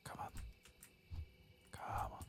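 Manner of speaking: normal talking voice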